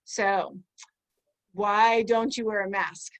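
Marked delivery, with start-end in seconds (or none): none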